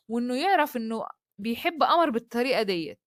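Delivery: usual speaking voice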